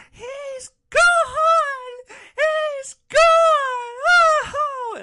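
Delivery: hammed-up sobbing shriek, very high and loud; exaggerated ear-piercing crying scream; mock shrieking wail. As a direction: wail it like an inconsolable passenger. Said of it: "like, ear-piercing shrieks, and she goes".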